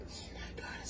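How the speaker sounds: breathless whispered Oh my God; awed whisper. Real, not acted. Cook whispering in awe at the smell of her kitchen, hushed.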